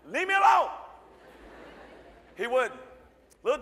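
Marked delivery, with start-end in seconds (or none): 0.1-0.7 much higher, louder "leave me alone"; retelling shouting at a pestering kid to go away; exasperated shout. Retold or imitated. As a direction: shoo the kid off, fed up.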